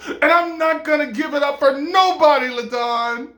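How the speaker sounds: high, loud refusal; defiant outburst. Acted. Woman refuses to give up crack, loudly defiant.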